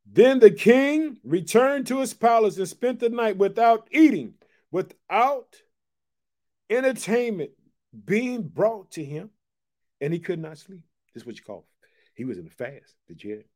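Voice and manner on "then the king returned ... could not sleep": slow, raised reading that fades at the end; scripture read to the congregation. Acted. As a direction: read the passage, letting it sink in. Preacher reads scripture aloud.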